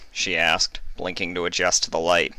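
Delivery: plain reading voice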